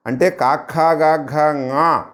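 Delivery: slow, each syllable apart, ঙా sung through the nose; nasal letter demo. Shown as a demonstration. Teacher slowly voicing the velar row for students, ঙా through the nose. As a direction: spell out కాఖా గాఘా ঙా slowly, ending nasal. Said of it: "ముక్కు సహాయంతో పలకాలి".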